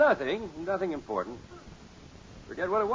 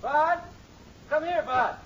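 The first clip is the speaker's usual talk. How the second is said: stern calling out; 11 semitones higher, slower than usual. Acted. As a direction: call him sharply, meaning business. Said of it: "he sounds like he means business".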